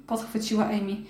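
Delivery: ordinary delivery, nothing marked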